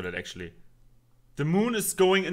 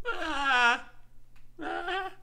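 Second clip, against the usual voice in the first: high anguished cry; mock-despairing cry; frustrated wail. Real, not acted. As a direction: wail at the unfair ending. Wailing at cliffhanger.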